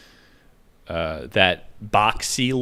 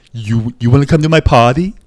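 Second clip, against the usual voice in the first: loud put-on come-hither voice; sing-song invite with a rising tune; imitating the girlfriend; 4 semitones higher, 9 dB louder, faster than usual. Imitating an ex-girlfriend inviting him to her party, loud put-on voice. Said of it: "she used to do this thing like".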